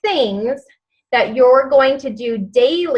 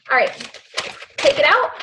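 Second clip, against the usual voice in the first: ordinary talk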